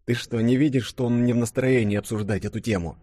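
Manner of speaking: plain reading voice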